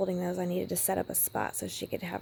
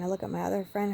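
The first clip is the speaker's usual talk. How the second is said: ordinary talk, nothing marked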